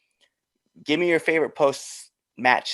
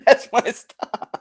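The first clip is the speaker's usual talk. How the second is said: slow laughing repeat; 12 semitones higher, 8 dB louder, much slower than usual. Pretend. Spotting Taco Bell while riding.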